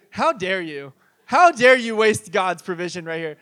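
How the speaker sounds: loud, hammed-up scolding; mock outrage. Pastor mock-scolds the crowd for tossing bread heels, loud and hammed up. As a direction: pretend to be appalled, playfully.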